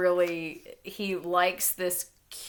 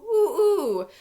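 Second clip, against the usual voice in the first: much higher, louder hooting rooster call; mock rooster crow; 13 semitones higher, 6 dB louder than usual. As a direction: crow the ooh like a silly rooster. Reading poem's rooster crow.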